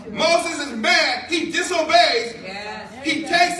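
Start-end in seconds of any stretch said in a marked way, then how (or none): none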